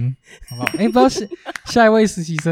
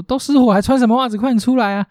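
higher-pitched mock yell of a panicked mother; frantic mother calling; 9 semitones higher than usual. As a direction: call him out of the fire, a mild mock yell. Mother yells at son in joke.

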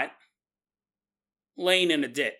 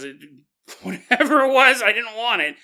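retold line broken by a laugh, pitched up; 6 semitones higher, 7 dB louder than usual. Abductee retelling the alien probe, laughing. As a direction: laugh through the quote.

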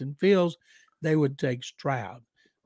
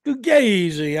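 hammed-up Italian Fugazi; 5 semitones higher, 7 dB louder, slower than usual. Real, not acted. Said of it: "that Waco Italian accent of his".

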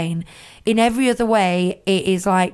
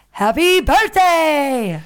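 silly voice; 8 semitones higher, 4 dB louder, much slower than usual. Shown as a demonstration. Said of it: "Why don't you just go".

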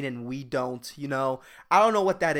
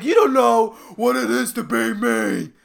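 higher, louder self-pitying whine; whining; 6 semitones higher, 6 dB louder than usual. Acted. A rich person complaining that nobody understands them, whining. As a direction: whine it like a spoiled rich kid. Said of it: "that's just whining".

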